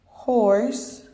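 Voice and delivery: normal talking voice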